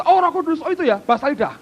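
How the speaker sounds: high, excited mock recognition; dismissive churchgoer. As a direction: parody a know-it-all listener's glib reaction. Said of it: "mesti berkata".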